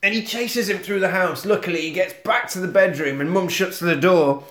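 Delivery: ordinary delivery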